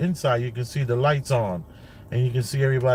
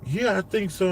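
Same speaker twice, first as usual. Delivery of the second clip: son's voice; 7 semitones higher than usual. Retold or imitated. Father voicing young son's words.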